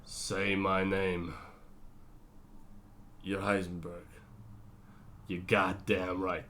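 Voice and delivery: slow, low and menacing; Walt's Heisenberg speech. Retold or imitated. Vlogger reciting Walt's famous speech, slow and low. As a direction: drag it out like a dark threat. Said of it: "We have the famous ... speech".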